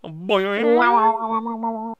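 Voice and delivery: high, long drawn boing; stretched cartoon boing.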